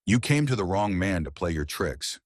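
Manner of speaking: usual speaking voice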